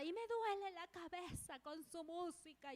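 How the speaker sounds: high-pitched whining protest; mock shrieky complaint. Neighbour complaining about the praise music, mock shrieky complaint. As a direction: imitate a fed-up neighbour wailing about noise.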